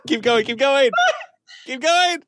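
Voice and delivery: loud, high, eager shout; excited urging. Real, not acted. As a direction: egg him on, all in. Cohost urges on, shouting.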